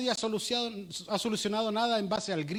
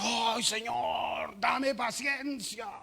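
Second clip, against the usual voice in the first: drawn-out, slow, exasperated sigh to God; exasperated plea; 3 semitones higher, much slower than usual. Retold or imitated. Parent fed up with the kids, slow exasperated plea to God. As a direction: stretch each word slowly, fed up with the kids.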